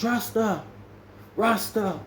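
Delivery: calling a name twice. Retold or imitated. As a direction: summon him from the room. Calling someone out of a room.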